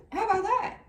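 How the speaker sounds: high mocking "how about that"; high mocking question. Real, not acted. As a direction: sing it up high to mock him. Repeated higher, mocking.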